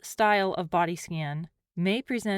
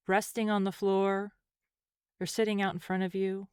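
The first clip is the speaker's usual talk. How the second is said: calm, unhurried meditation voice with long pauses; guided meditation. Acted. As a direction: gently guide the listener through a body scan, leaving space between phrases.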